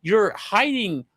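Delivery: high, drawn-out accusation; accusing outburst. Retold or imitated. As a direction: call her out in disbelief. Accusing wife of hidden charms.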